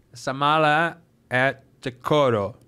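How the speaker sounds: very slow, low, laboured words; stroke-slurred speech. Retold or imitated. Luggage salesman to a browsing customer, stroke-slurred speech. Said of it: "teve um derrame".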